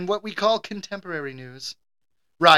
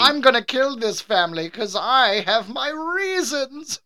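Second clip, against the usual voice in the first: slow, higher mock-menacing voice; scary maid; 6 semitones higher than usual. Maid plotting to kill the family, creepy and slow. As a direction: draw it out like a creepy villain. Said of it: "that's a scary maid".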